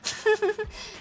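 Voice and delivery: bright high giggle. Real, not acted. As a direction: laugh at yourself in a squeak. Laughs at own forgetfulness.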